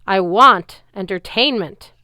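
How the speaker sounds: demanding growl. Acted. Giant demanding a show from Luke, loud slow growl. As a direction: demand it like a spoiled king. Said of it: "Antaeus growled".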